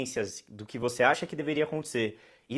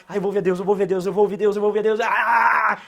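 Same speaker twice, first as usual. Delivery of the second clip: excited; 6 semitones higher, 9 dB louder than usual. Retold or imitated. Mimics an over-eager first-timer.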